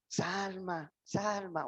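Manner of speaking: a father's soft, patient call; patient and gentle. Retold or imitated. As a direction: call her name with endless patience. Patient father calling daughter.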